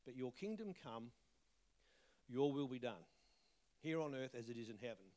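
ordinary talk

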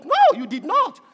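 loud, high rebuttal; forceful contradiction. Acted. Preacher answering a parent's excuse, indignant denial.

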